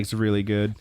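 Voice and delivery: usual speaking voice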